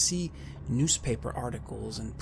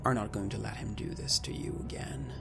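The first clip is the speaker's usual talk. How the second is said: hushed resolve; 4 dB quieter than usual. A narrator's reading. GM narrates inner resolve.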